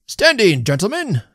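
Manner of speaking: loud, high call to order; shouting. A narrator's reading. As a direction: rouse the whole table to stand. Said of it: "shouted the indefatigable little man".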